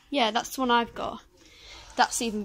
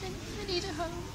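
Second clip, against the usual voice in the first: pet voice; 5 semitones higher, 6 dB quieter than usual. Pretend. Voicing a pet shop animal.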